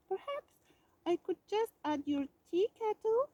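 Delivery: high, eager, hopeful ask. A narrator's reading. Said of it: "said Mouse hopefully".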